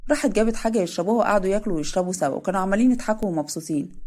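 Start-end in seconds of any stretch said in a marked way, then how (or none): none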